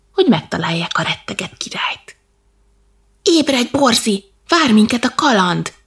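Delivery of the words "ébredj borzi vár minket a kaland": high, louder wake-up call; storybook shout, eager and raised. A narrator's reading. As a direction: rouse a sleeping friend for adventure. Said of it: "kiáltott Dorian".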